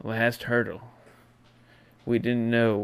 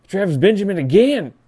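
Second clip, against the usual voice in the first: higher, louder ecstatic call of the name; excited shout; 9 semitones higher, 11 dB louder, faster than usual. Acted. Host to fans, excited shout of the returner's name. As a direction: shout the name like the crowd on its feet.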